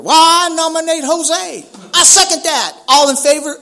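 high, loud, stretched-out call; calling out nominations. Acted. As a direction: imitate eager members shouting motions. Acts members calling out at meeting.

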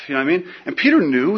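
normal talking voice

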